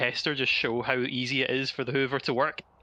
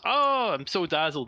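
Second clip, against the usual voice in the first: drawn-out, higher mock exclamation; playing it up; 5 semitones higher, 4 dB louder than usual. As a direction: ham up being dazzled. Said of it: "he plays it up".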